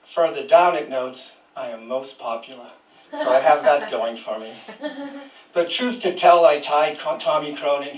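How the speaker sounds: projected poem recital, measured phrasing with firm stresses; poem reading. Acted. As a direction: read your poem out to the room. Poet reads own poem to audience.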